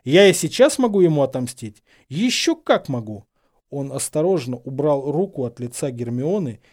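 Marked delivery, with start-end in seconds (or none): 0.0-3.2 raised, indignant voice; heated, emphatic threat; ringing with anger. A narrator's reading. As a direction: assert it with angry force. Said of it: "звенящим от злости голосом произнёс".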